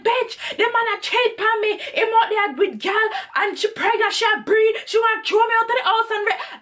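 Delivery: high, rapid-fire Jamaican patois rant; angry Jamaican accent. Retold or imitated. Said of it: "I'm in my accent totally".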